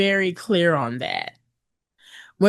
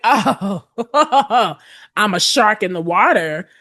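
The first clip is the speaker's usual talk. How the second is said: mock predatory glee; 3 semitones higher, 4 dB louder than usual. Voicing a predatory client's glee.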